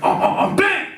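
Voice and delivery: rhythmic grunts into a high bang; mock grunting thrusts. Buck humping the truck, grunting then a high bang. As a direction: ham up the buck's grunts to a yelp. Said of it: "He's like".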